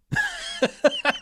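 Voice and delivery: sudden high-pitched burst of laughter. Real, not acted. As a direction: laugh out at the absurdity. Laughing at own joke.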